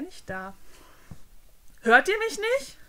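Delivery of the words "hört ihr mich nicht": loud, much higher indignant cry; ignored servant shouting at the prince; exasperated shout. Acted. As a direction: shout to be heard.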